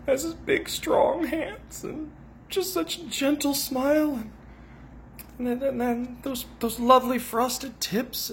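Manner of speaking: high dreamy gushing voice; swooning fangirl. Acted. Parody fan gushes about her hero Vic, high dreamy swoon. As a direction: swoon over him like a lovestruck fan.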